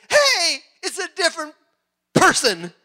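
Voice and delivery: high, loud mock amazement; acted discovery, starting very high; amazed exclamation. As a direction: exclaim it as an astonished villager. Villager retold amazed by mirror.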